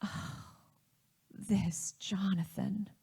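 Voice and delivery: soft, slow, breath-heavy sigh; mom describing baby. Retold or imitated. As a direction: sigh it softly over the baby in your lap. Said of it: "she describes him, saying".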